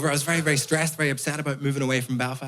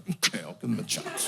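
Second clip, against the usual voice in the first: grudging agreement; 6 semitones lower, 7 dB quieter than usual. Retold or imitated. Grudgingly gives in to mum.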